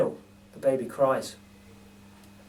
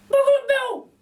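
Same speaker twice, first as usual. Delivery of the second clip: put-on very high, louder toddler voice; baby voice; 6 dB louder, slower than usual. Narrator voices baby repeating a name, squeaky. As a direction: babble it like a tiny child. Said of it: "says the baby".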